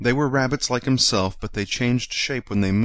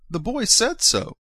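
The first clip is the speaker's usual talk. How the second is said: near tears; 14 semitones higher than usual. A narrator's reading. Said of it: "he nearly began to cry".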